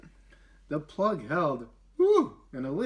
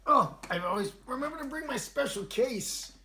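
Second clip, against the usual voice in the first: excited; 10 semitones higher than usual. Real, not acted. Host showing her special case.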